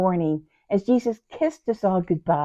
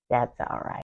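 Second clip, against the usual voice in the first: soft, lowered near-whisper; hushed reassurance; 6 semitones lower, 9 dB quieter than usual. Acted. Unnamed sister consoles herself that Jesus knows, hushed. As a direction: drop to a hush, consoling yourself.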